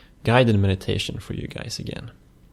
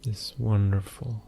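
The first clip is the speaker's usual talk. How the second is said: soft, unhurried meditation voice with long pauses; hushed, even delivery, letting phrase ends fade; guided meditation; 5 dB quieter, slower than usual. Acted. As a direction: guide the listener gently into stillness, phrase by phrase.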